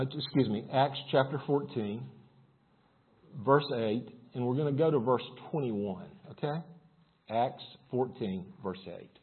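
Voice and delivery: usual speaking voice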